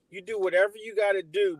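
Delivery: usual speaking voice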